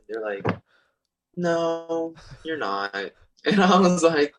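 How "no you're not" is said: a lightly voiced doubtful friend, higher; mock friend voice. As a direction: tease him like a skeptical friend. Friends doubting him, mock high voice.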